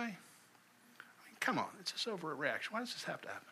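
dropped to a near whisper, pleading why; mock whispered protest; hushed complaint. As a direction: voice the doubter's grumble under your breath. Voicing the listener's doubt.

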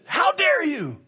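high-pitched burst of outrage; indignant, voice leaping up; thunderbolt rebuke. Acted. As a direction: fire it off like a thunderbolt. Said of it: "this sort of thunderbolt corrective".

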